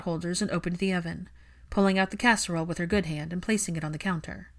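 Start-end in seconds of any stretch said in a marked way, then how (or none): none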